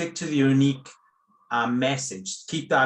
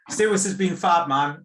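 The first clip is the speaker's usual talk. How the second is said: louder, brighter thanks to a guest; upbeat sign-off; 5 semitones higher, 5 dB louder than usual. Real, not acted. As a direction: cheer the guest as the call wraps up. Host warmly wrapping up the call.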